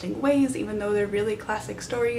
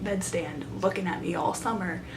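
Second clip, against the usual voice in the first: ordinary delivery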